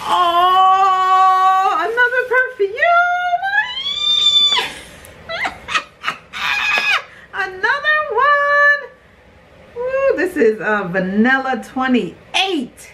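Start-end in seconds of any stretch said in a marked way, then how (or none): 0.1-10.1 very high, drawn-out delighted squeal; ecstatic squeal. Real, not acted. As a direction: squeal and stretch it out, overjoyed. Thrilled on finding another perfume.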